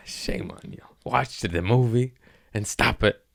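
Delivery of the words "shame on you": low whispered "shame on you". Pretend. Scolding people who skipped Terminator, whispered. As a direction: hush it like a secret scold.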